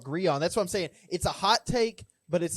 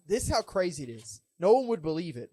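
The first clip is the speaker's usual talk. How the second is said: usual speaking voice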